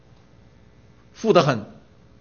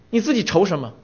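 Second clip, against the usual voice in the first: ordinary talk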